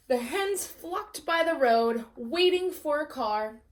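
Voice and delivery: usual speaking voice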